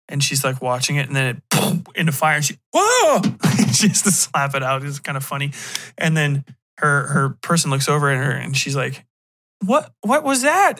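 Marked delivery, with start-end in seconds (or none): 2.7-3.7 high, loud whoa of alarm; acted jump of surprise; startled yelp. Woman startled by sudden flames, startled yelp. 9.6-10.8 high, slow, startled woman; acted bewildered alarm, pitched right up; startled question. She asks about the flare-up, startled.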